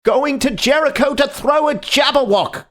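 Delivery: very high, loud frantic cry; frantic shout; wild cry. A narrator's reading. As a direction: shriek it in exasperation. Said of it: "cried the other".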